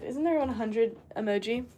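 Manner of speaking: ordinary talk, nothing marked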